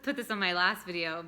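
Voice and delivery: ordinary talk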